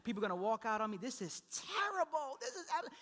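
hammed-up despair, pitch climbing; dramatic mock hopelessness; sermon despair. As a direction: exaggerate a preacher's midweek panic, stretching the key words. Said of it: "sermon despair".

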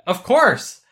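loud, eager, convinced; excited. Retold or imitated. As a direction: blurt it out with total conviction. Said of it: "And I'm like".

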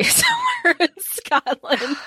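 high, laughing through the words. Real, not acted. Reader joking to co-host about her soulmate, laughing. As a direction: break into laughter while saying it.

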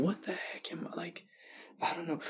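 soft, breathless whisper; hushed, panicky aside to himself. Retold or imitated. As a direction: whisper it like a secret freak-out. Inner panic at the banquet.

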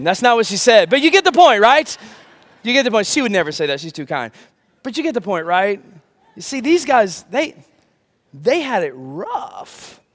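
ordinary talk

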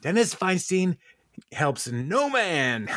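high, very slow hammed-up gloat; gloating tycoon. Feinstein gloating as they leave, high slow mock gloat. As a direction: drag out each word like a smug billionaire. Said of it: "Dennis was like".

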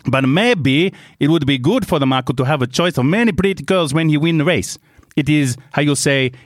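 halting, put-on Italian English; Italian accent. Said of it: "try and do an Italian accent".

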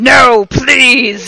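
high, loud, wailing mock plea; begging. A narrator's reading. Heroine given a gun by Voldemort, wailing mock plea. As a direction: beg with hammed-up desperation. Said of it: "I begged".

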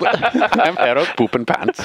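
playful mock German name intro; fake German accent. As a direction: say it like a silly German. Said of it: "like, fake German name".

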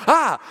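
high, loud imitated cry; mock startled yelp. Stranger accosted by a praying girl, mock startled yelp. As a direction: yelp as if ambushed, played for laughs.

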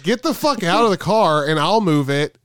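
exasperated order. Retold or imitated. Stranger at the car window telling the stoned driver to get out, exasperated. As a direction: act out a fed-up stranger giving orders.

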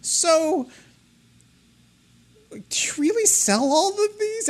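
high, slow, wide-eyed asking voice; a naive, curious young woman drawing it out; imitating Emily. Imitating Emily asking RV staff, high and slow. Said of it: "I could hear her saying that too".

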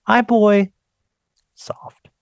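fading to a whisper. Shown as a demonstration. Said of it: "It's almost like praying".

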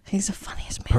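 whispered aside; breathy unvoiced whisper.

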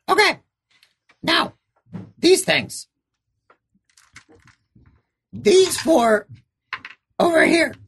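high, halting little creature; squeaky creature voice. Acted. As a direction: pipe up like a small critter. GM voices small creature Skeevers.

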